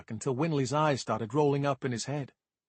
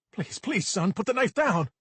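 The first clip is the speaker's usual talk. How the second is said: higher, louder, begging father; desperate pleading; 8 semitones higher, 4 dB louder than usual. Acted. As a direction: beg him to drop the knife. Father begs son with knife.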